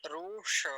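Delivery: रूष with a long, drawn-out oo; exaggerated long oo in रूष. Shown as a demonstration. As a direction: hold the vowel of रूष as "roosha". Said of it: "ऐसा बोलते".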